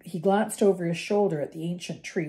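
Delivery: plain reading voice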